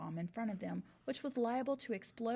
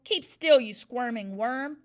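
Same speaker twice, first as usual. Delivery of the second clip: shouting; 11 dB louder, slower than usual. A narrator's reading. Headmistress shouts at boy.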